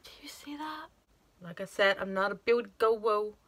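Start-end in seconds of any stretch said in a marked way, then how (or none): 0.0-0.9 awed hushed whisper. Real, not acted. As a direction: whisper in awe, higher. Admiring the shade.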